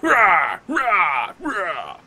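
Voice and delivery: loud brutish battle grunts; orc war grunts. Acted. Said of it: "They're like".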